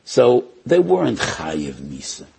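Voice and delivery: ordinary talk, nothing marked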